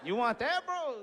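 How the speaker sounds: high, cocky taunt. Retold or imitated. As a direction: goad him, laughing. Taunting the opponent.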